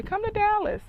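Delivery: high, loud, breezy mock invitation; mock friends' invite. Mocking Dallas friends' casual invite, high and loud. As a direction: toss it off like it's nothing. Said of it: "and they say".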